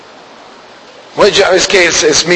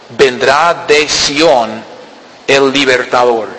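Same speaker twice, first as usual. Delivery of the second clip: lower, slower restatement of "Vendrá de Sión el libertador"; slow low explanation; 3 semitones lower, slower than usual. Acted. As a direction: repeat "Vendrá de Sión" low and very slow, stretching each word. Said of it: "¿Cuándo?".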